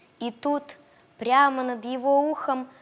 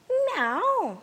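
high meowing cat; 12 semitones higher than usual. Acted. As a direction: meow like a hidden cat. Unseen cat meows.

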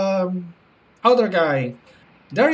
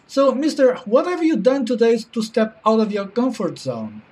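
normal talking voice

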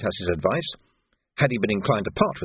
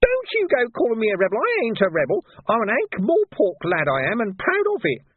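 put-on high, louder, outraged boy; indignant shouting urchin; 11 semitones higher, 3 dB louder than usual. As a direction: protest hotly to the major. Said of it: "Nobby shouted".

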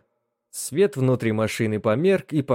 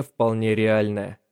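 ordinary delivery, nothing marked

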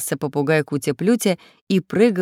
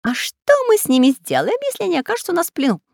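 high, loud parrot cry; shouting parrot; 12 semitones higher, 4 dB louder, faster than usual. Acted. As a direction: squawk it out eagerly. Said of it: "спросил попугай".

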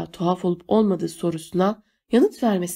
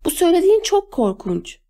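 high-pitched, appalled protest; indignant cry; 10 semitones higher, 4 dB louder than usual. A narrator's reading. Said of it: "diye bağırdı Claire". (tiz sesle, dehşetle itiraz ederek)

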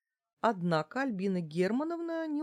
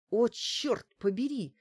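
slow, raised exclamation of annoyance; cursing; 5 semitones higher, 3 dB louder than usual. A narrator's reading. Woman struggling to hold the door, cursing. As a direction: curse it out, stretched and higher. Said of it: "выругалась я".

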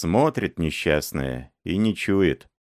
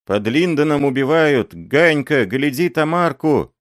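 high excited yell; shouting child; 8 semitones higher, 4 dB louder than usual. A narrator's reading. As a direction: shout the news downhill. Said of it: "кричит Ганька".

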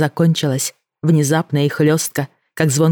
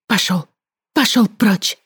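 shouting; 5 semitones higher than usual. Acted. Отгоняет зверя.

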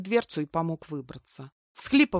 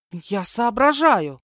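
loud, slow, higher protest; offended; 4 semitones higher, 8 dB louder, slower than usual. A narrator's reading. Captive woman answering an insult, offended. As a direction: protest with wounded pride. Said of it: "оскорбилась я".

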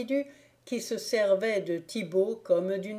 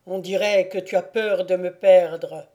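louder, mocking the clinging drunk; mocking stranger; 6 dB louder than usual. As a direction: tease him, loud. Stranger voiced in read story.

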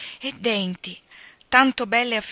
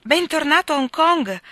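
higher, louder welcoming cry; warm welcome; 8 semitones higher, 9 dB louder than usual. Acted. Fiona welcoming Mark back at the door, joyful. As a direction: greet him gladly at the door.

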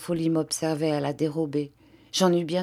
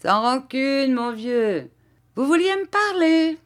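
high-pitched gleeful taunt, rising higher; Garofoli, laughing tone; 8 semitones higher, 3 dB louder than usual. A narrator's reading. Padrone taunting the departing master, laughing tone. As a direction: call after him in mock friendliness. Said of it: "dit Garofoli en riant".

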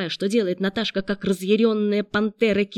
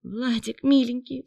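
higher-pitched tender begging; imploring; 6 semitones higher than usual. A narrator's reading. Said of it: "взмолилась девушка".

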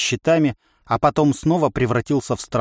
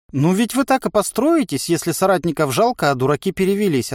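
lightly voiced inner voice, pitched up; wry inner voice; 7 semitones higher than usual. A narrator's reading. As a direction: answer drily in a lifted pitch. (внутренний голос, чуть иронично)

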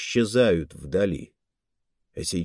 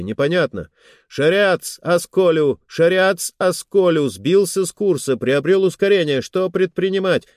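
alarmed report; 6 semitones higher, 4 dB louder than usual. Acted. Panicked officer reports ship hit.

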